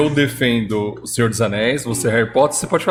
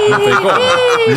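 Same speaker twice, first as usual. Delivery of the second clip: loud, high, drawn-out objection; half-joking indignant outcry; playful outraged protest; 5 dB louder, slower than usual. Real, not acted. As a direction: protest at the top of your range. Mock outrage at fandom comparison.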